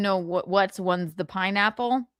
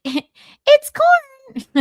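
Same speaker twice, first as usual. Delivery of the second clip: laughing squeal; 10 dB louder than usual. Retold or imitated. Laughing mock cop voice.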